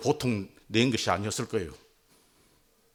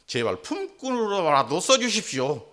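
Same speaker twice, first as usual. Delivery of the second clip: pleading son; 5 semitones higher, 4 dB louder than usual. Retold or imitated. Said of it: "아들 자격 없음을 고백합니다".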